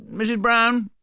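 high, muffled call of Missus Brown. A narrator's reading. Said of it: "cried Paddington in a muffled voice".

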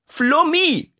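high, loud call of the name; shout. Acted. Mother yells for her youngest, loud alarm. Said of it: "schrie Iris".

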